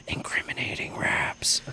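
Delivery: breathy whispered aside. Real, not acted. Recalling old incriminating raps, whispering. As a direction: breathe it like a guilty secret.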